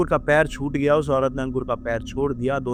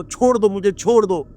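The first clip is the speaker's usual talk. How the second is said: higher, louder desperate plea; screaming; 6 semitones higher, 5 dB louder than usual. Retold or imitated. Ankur begging the ghost to release him, loud scream. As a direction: yell to be let go, pushing the voice up. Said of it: "बहुत जोर जोर से चिल्ला रहा था वो".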